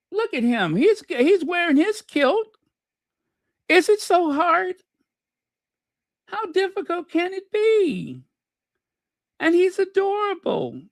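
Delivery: high-pitched cooing over someone cute; gushing adoration. Real, not acted. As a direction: gush in a high, doting sing-song. Gushing over boy in kilt picture.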